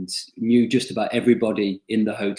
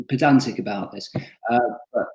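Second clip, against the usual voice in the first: ordinary delivery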